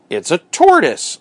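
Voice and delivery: high, drawn-out storybook exclamation; excited cry. A narrator's reading. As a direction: announce a surprising sighting. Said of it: "I cried".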